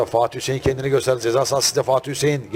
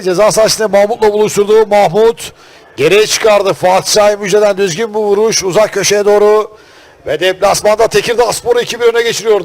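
turkish football commentator; 5 semitones higher, 7 dB louder than usual. Real, not acted. Goal replay commentary, raised.

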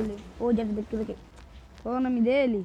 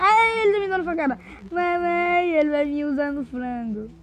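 high, loud, fast pretend crying out; mock panic; 5 semitones higher, 7 dB louder than usual. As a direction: wail as if being stabbed, half in play. Personagem levando facadas no jogo. (grito de pânico fingido, agudo, alto e rápido)

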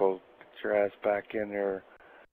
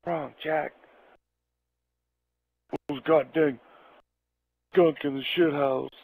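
raised, alarmed voice for Jack; voicing Jack; 5 semitones higher than usual. Telling Chris how Jack burst in, a spooked friend's voice. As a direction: blurt it out like a spooked buddy.

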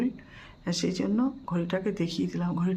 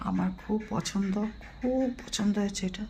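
normal talking voice

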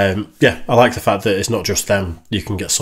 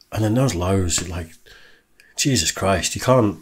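ordinary talk

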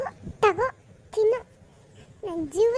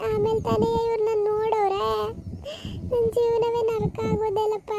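put-on remorseful old aunt; 2 semitones higher than usual. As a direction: wail out your regret, then curse her. Plays weeping old aunt. (ಪಶ್ಚಾತ್ತಾಪದ ಅಳುವ ಚಿಕ್ಕಮ್ಮ)

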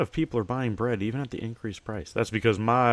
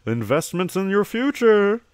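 high and louder salesman line; sales-pitch flourish; 11 semitones higher, 8 dB louder than usual. Acted. Shopkeeper pitching investment to a businessman, loud and high. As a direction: sell it like an ad slogan.

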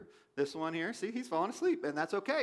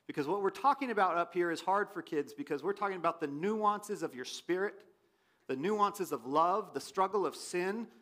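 usual speaking voice

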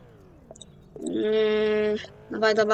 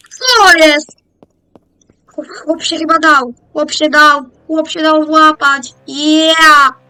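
excited; 4 semitones higher, 10 dB louder, slower than usual. Real, not acted. Criminal surrenders, celebrating.

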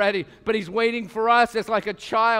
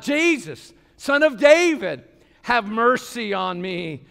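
passionate cry; 3 semitones higher, 5 dB louder, slower than usual. Shown as a demonstration. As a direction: call out to Jesus with all your passion. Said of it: "Did you hear it in his voice?".

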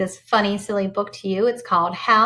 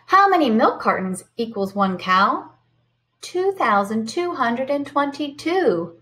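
lively storybook read-aloud; sing-song picture book voice; reading to children; 5 semitones higher, slower than usual. Acted. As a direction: read it bright and animated for the kids.

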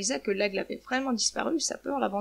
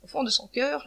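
plain reading voice

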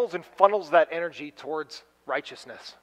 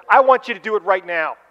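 stern parent; 8 dB louder than usual. Retold or imitated. Parent demanding, acted.

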